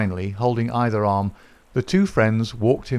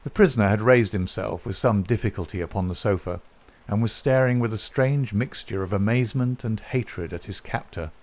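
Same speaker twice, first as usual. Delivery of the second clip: ordinary delivery, nothing marked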